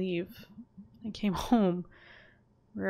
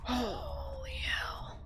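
awed whisper; 5 dB quieter, much slower than usual. Real, not acted.

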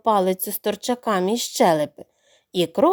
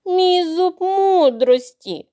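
higher, very slow theatrical moan; groaning; 7 semitones higher, 4 dB louder, much slower than usual. Acted. Crocodile moaning to fool the youngest duckling. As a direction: wail the tooth like a tragedy. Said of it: "стогнав він".

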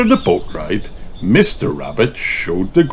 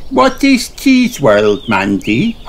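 put-on high, slow little girl asking; Peppa voice; 6 semitones higher, slower than usual. Peppa asks Mandy about Cheese World, curious little girl. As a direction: sound like a curious small piglet.

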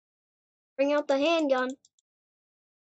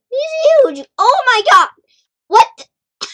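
loud, high burst of disbelief; blurting out in amazement at a huge monster; astonished yelling; 7 semitones higher, 9 dB louder than usual. Real, not acted. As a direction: shout it out in pure shock. Giant monster appears in game.

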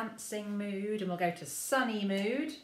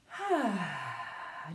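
slow open-mouthed sigh out; long relaxed sigh. Shown as a demonstration. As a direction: let a long breath go. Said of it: "have a little bit of a sigh".

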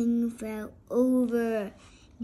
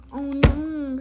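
dismayed cry; 3 semitones higher, 9 dB louder than usual. Acted. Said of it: "said the king".

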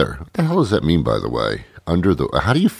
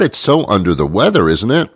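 normal talking voice

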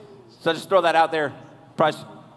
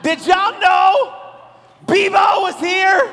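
mock announcer shout; 12 semitones higher, 8 dB louder, much slower than usual. Hamming up a joke slide reveal.

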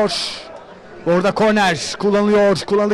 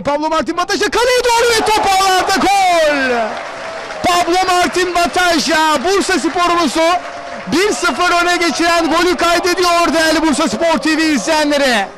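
screaming football commentator; 6 semitones higher than usual. Real, not acted. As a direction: yell the goal at the top of your voice. Goal call in live commentary.

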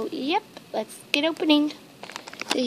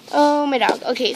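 ordinary talk

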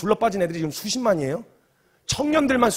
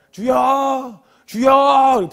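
loud, drawn-out prayer cry, re-enacted; calling out to the Lord; 5 semitones higher, 6 dB louder, much slower than usual. Joining a lone young leader's prayer call, loud calling out. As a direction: cry out "Lord!" at raised pitch. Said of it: "불러줬어요".

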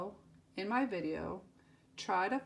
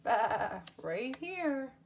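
normal talking voice

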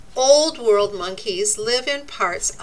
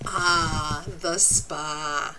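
lazy spa sigh; 6 semitones lower, 4 dB quieter, much slower than usual. Acted. Voicing relaxed bathing monkeys.

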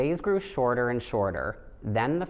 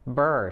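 shivering brr; 3 semitones higher, 5 dB louder than usual. Acted. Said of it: "she said".

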